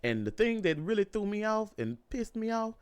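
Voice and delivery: usual speaking voice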